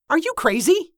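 high-pitched disbelieving question; incredulous. A narrator's reading. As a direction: balk at the idea, pitch leaping up. Sceptical reply to reckless plan.

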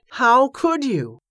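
slow, high, drawn-out accusing cry; narrated shout. A narrator's reading. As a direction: raise the voice and stretch each word in outrage. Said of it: "he shouted".

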